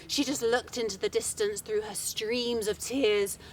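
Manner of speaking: plain reading voice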